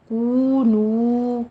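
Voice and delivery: کونو with the و drawn out slowly; long vowel. Shown as a demonstration. Teacher repeats the correct long کونو. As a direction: hold the vowel in کونو for two counts. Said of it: "ٹو ٹو count کرنا ہے".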